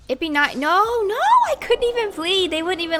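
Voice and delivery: high, loud cry of disbelief; dismayed outcry. Real, not acted. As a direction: cry out as the game refuses you. Player denied plea, dying sim.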